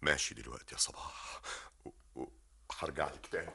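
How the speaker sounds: hushed, faltering goodbye; barely able to speak; shaken whisper. Acted. Medhat on tape leaving Sabah, shaken.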